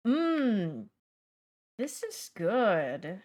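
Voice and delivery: slow, drawn-out tasting line; food influencer voice. Retold or imitated. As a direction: savor it slowly. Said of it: "I just see".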